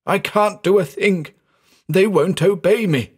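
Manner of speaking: high, frantic moaned plea; husky, frantic. A narrator's reading. Terrified man losing control before his friend, frantic. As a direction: pitch it up in helpless panic. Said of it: "had become husky and frantic".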